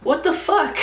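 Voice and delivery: high, loud outraged rant; acted fury. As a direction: retell it as an indignant, incredulous outburst. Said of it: "I got so furious".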